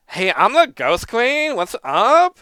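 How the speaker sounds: high, slow, loud lady voice; man doing a woman's voice. Shown as a demonstration. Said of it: "you want me to do a lady voice".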